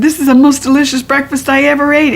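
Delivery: put-on high, fast excited kid voice; little girl voice. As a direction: gush like a small child. Junie B quote, fast and far.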